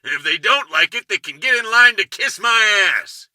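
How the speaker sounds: roaring angry. A narrator's reading. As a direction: chew out an employee at full roar. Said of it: "The roar of Hank Caswell chewing".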